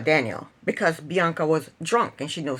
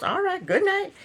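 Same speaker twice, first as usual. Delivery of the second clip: dismissive; 10 semitones higher than usual. Retold or imitated. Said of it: "She goes".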